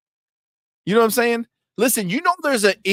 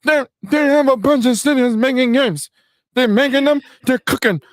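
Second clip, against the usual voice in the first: higher, louder hyped-up fanboy voice; mocking PlayStation fan; 6 semitones higher, 3 dB louder than usual. Mocking a PlayStation fan, higher, louder hype voice. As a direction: ham up a gloating fan crowing about their studios.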